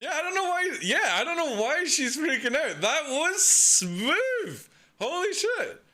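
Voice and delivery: high-pitched, incredulous excitement; real amazement. Real, not acted. Viewer raving about the smooth landing, high excitement. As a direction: exclaim way up high, stretching out the praise.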